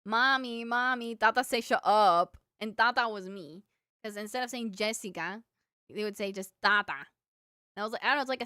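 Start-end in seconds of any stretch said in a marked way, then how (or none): 0.1-2.4 put-on loud whiny little-kid tattle; a toddler calling for mommy; tattling toddler. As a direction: tell on your sister to mommy. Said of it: "run to his mom and be like".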